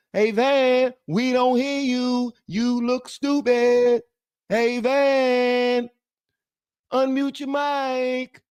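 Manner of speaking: very high, drawn-out holler; long, stretched falsetto calling; viewers calling out. Acted. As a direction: slowly call out each phrase in a high mock voice. Chat calling to muted host.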